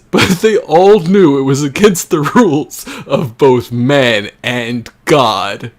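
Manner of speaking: mock-grave proclamation; overly serious dramatic delivery; dramatic reading. Rereads the line grandly.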